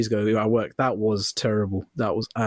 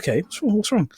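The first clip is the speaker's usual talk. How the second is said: higher-pitched mock concerned questions; retold concern; 8 semitones higher than usual. Colleagues quizzing the hurt lad, retold concern. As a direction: ask it high, imitating worried mates.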